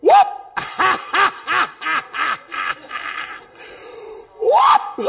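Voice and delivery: high whoop into rapid cackling laugh; yelp, then a long stuttering laugh; imitated ecstatic whoop. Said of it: "and she'd go".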